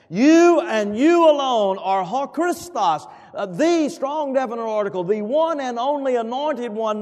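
fiery sermon climax; preaching at full force. Acted. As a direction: build to a roaring proclamation. Preaching climax on Peter's confession.

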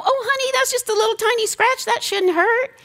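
high, fast brushing-off mom voice; high, quick dismissive mother to crying daughter. Retold or imitated. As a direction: breezily wave off the little scratch.

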